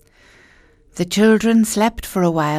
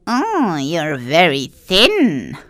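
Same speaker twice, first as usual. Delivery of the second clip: put-on old witch voice; much slower than usual. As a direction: lure them in as a sly old witch. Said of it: "said the witch".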